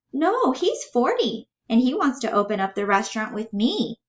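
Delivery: a dreamer in a high voice; childlike, hopeful Bella. Acted. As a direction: play her high and earnest.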